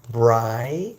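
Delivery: "Bright" drawn out with a rising glide; pronunciation demo. Shown as a demonstration. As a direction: stretch the vowel of "Bright" upward for learners. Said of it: "Pronounce it like that".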